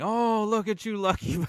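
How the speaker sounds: high, loud mock yell; hammed-up envious prisoner calling out; imitated yelling. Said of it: "and he's yelling".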